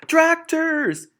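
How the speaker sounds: very high, loud alarm shout. Acted. Mister Fox shouting a warning to his family, loud and high. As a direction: yell the warning. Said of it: "shouted Mister Fox".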